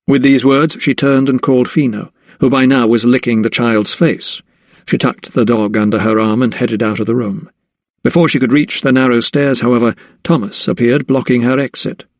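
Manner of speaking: plain reading voice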